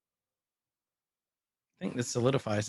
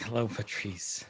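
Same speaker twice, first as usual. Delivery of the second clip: soft low put-on voice; a hint of a spooky whisper; hushed creepy greeting; 4 semitones lower, 7 dB quieter than usual. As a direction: greet him like a ghost, softly. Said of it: "It'd been funny to be like".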